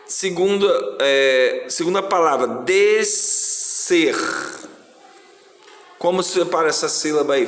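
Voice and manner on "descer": "descer" drawn out, split into des and cer; "descer" stretched out for the class; syllable by syllable. Shown as a demonstration. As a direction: dictate "descer" slowly in two separate syllables. Teacher dictating a word for syllable division.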